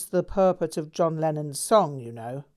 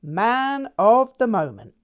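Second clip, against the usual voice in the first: gleeful boast; 6 semitones higher, 9 dB louder than usual. A narrator's reading.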